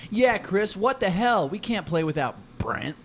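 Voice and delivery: high, loud acted outrage; indignant protest. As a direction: confront the game master, loudly indignant. Acted quarrel as player Ken.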